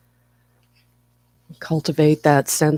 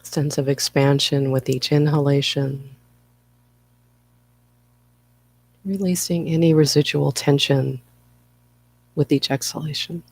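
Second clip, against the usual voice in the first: guided meditation. Acted. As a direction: ease listeners into stillness, phrase by unhurried phrase. Leads a guided breathing meditation.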